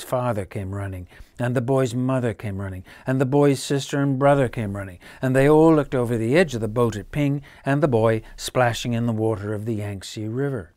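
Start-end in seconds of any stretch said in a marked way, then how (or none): none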